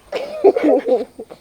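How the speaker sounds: high-pitched burst of laughter. Acted. Two friends bantering over words, high laugh.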